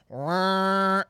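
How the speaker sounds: sustained machine "wah"; drawn-out mechanical drone; imitated motor noise. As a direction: imitate a motor whirring into action. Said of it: "then you just hear".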